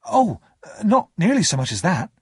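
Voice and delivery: quick, startled-sounding denial. A narrator's reading. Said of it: "I said quickly".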